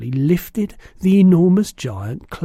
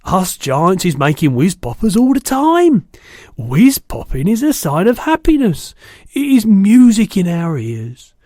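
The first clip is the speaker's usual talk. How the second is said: louder, higher, gleeful giant voice; excited giant; 3 semitones higher, 8 dB louder than usual. A narrator's reading. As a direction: rave about it joyfully. Giant enthuses about whiz popping.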